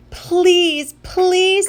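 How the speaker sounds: high, urgent begging; pleading. Acted. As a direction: beg like an embarrassed kid. Boy begs mom not to greet aunt.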